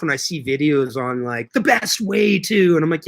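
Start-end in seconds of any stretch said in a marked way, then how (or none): 1.5-2.7 higher, slow, stretched-out title; mock video hype. Mocking online gardening videos' titles, drawn-out hype. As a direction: mimic an overconfident how-to video.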